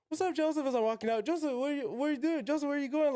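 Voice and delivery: high, mock-excited classmates calling his name.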